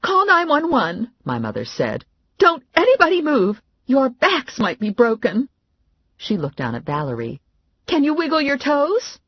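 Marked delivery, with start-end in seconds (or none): 0.0-5.5 frantic mother taking charge; mother's voice. Acted. Mother after the fall, high alarmed orders. 7.9-9.2 frantic mother taking charge; mother's voice. Acted. Mother after the fall, high alarmed orders.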